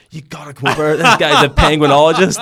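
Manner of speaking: high, loud call across the bar; mock excited shout to his wife to come over. Stranger calls his wife across the bar, excited loud shout. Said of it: "he calls his wife".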